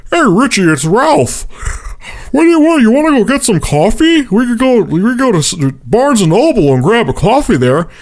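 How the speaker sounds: high, creepy stalker impression; Ralph. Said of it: "sounded exactly like Gary Oldman's character".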